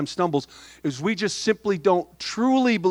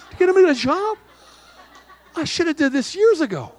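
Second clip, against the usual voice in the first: much higher and louder, playful triumph; joking glee; 12 semitones higher, 3 dB louder than usual. Retold or imitated. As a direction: crow it out as a joke to the wife. Joking punchline to his wife.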